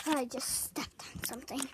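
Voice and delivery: whispering under breath; whispered aside. Real, not acted. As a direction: drop to a whisper. Sudden quiet aside.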